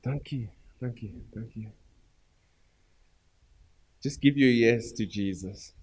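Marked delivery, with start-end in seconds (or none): 0.0-1.7 fading thanks. Real, not acted.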